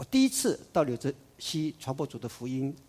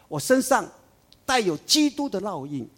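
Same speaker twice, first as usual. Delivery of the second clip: loud, high declaration; Paul proclaiming; 6 semitones higher, 7 dB louder than usual. Retold or imitated. Paul declares he bears Christ's marks, loud and high. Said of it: "保罗中途说".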